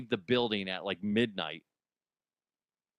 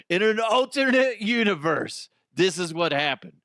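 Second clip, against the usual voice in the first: announcer intro; 6 semitones higher, 5 dB louder than usual. Acted. Dramatic setup of a fake scene.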